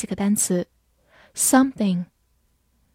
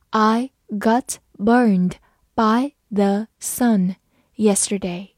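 very slow, word by word; slow reading; slower than usual. Shown as a demonstration. Teacher modelling for students, very slow and clear. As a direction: read it slowly so they can repeat. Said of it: "好，慢慢来读。".

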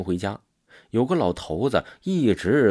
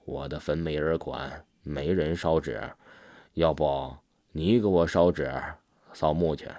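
put-on low, soft old ghost's voice; hushed, low-pitched ghostly plea; old man ghost; 5 semitones lower, 4 dB quieter than usual. A narrator's reading. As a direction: speak low and quiet as a dead old man. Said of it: "这老头儿说啊".

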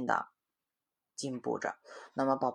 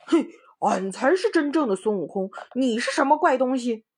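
louder, higher, quarrelsome; arguing pig-headed Monkey; 11 semitones higher, 9 dB louder than usual. Acted. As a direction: shout back at the impostor. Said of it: "那个猪头猴身说". (猪头猴身大声争吵)

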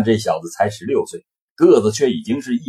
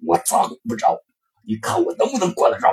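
changed, strained voice; 11 semitones higher than usual. Acted. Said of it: "自己的嗓子也变了声调说".

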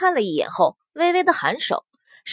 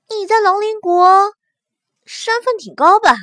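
high, louder probing question; curious young woman; 7 semitones higher, 5 dB louder than usual. A narrator's reading. Huo Shui probes the new girl's rank, high and curious. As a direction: lift the voice to probe playfully.

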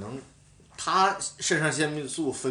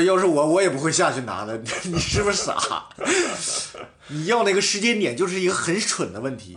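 higher, louder mocking rebuke with a laugh; mock the teammate for a dumb request; fed-up scolding; 6 semitones higher, 4 dB louder than usual. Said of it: "在旁边听烦了". (队友听烦了训斥汤姆，不耐烦又带嘲笑)

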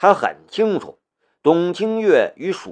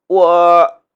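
drawn-out, hesitant first syllable; cowed; 3 semitones higher, 6 dB louder than usual. A narrator's reading. Park worker freezes under a threat, cowed. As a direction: falter loudly on one word. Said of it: "顿时怂了".

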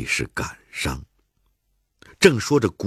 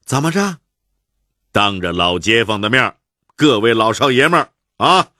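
mock bravado; 8 semitones higher, 6 dB louder than usual.

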